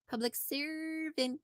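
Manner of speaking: high, slow, drawn-out animal call; frauditor mating call. Retold or imitated. Narrator mocks the frauditor's call as a mating cry. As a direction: bellow it like a bird's mating cry. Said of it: "the rare mating call".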